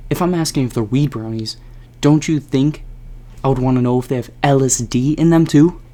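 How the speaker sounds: heated argument. Retold or imitated. To Jared about hidden LSD, rising heated demand. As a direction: push back hard.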